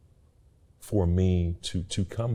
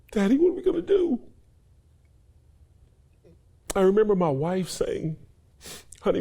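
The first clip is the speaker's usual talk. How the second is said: emotional; 9 semitones higher than usual. Real, not acted. Tearful retelling of losing home.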